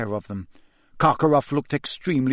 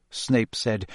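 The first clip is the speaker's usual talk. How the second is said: usual speaking voice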